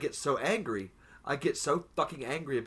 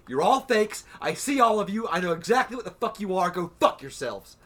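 loud, higher accusing rant; retold outburst; 4 semitones higher, 6 dB louder than usual. Telling fake fans off on the show, loud and furious. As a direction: tell the fakes off, loud and high. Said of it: "I'm over here going".

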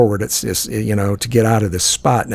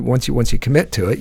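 ordinary talk, nothing marked

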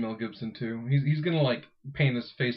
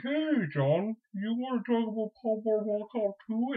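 imitating David; 8 semitones higher than usual. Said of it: "David's like".

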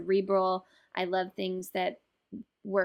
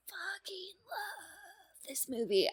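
breathy, slow whispered gush; 11 semitones higher, 9 dB quieter, slower than usual. Real, not acted. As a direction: whisper it like a secret. Gushing whispered confession.

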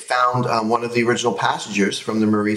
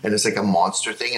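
ordinary talk

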